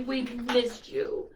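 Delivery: plain reading voice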